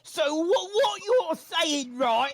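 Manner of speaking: high, slow cockney rat voice; cheeky rat. Acted. Rat Radicus quizzing the party, high sly voice. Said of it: "He looks at you and he says".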